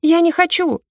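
high frightened cry of refusal; desperate protest. A narrator's reading. Girl screams at her abductors, high frightened cry.

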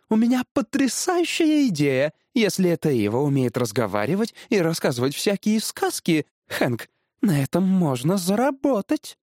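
high-pitched, eager scheming; suddenly animated wife. A narrator's reading. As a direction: burst out with your brainwave. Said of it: "вдруг оживилась женщина". (оживлённый высокий женский голос)

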